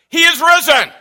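through the roof. Real, not acted. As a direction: blow the roof off. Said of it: "through the roof".

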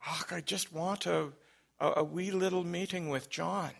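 soft imitated Scottish caller; thick Scottish accent. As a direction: gently insist in a put-on Scots voice. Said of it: "had a very thick Scottish accent".

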